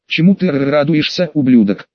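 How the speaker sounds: ordinary delivery, nothing marked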